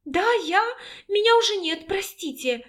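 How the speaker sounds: high, hurried fearful voice; frightened apology. A narrator's reading. Scared woman apologises to the alpha while leaving, frightened.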